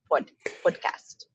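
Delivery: slow PODCAST with a rounded first vowel; savoured mimicry of accented "podcast"; imitating host's pronunciation. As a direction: draw out "pod", then "podcast", copying the host. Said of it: "I love how you say podcast".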